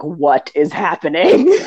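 drawn-out, half-laughing disbelief; baffled visitor stretching out the words; mock bewilderment. Imitating Brits baffled by an American Renaissance festival, mock bewilderment. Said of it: "when they come ... and they're like".

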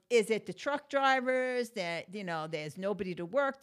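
normal talking voice

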